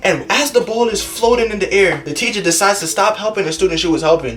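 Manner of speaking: ordinary talk, nothing marked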